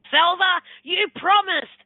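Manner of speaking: loud, high scolding call; angry husband. Retold or imitated. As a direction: imitate an exasperated husband calling out an accusation. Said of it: "Don't be angry at me".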